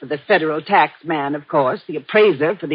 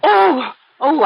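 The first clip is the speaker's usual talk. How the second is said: sharp high cry of alarm; a quick frightened oh; startled gasp; 10 semitones higher, 4 dB louder than usual. Acted. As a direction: jump at the noise. Housekeeper startled by a stumble.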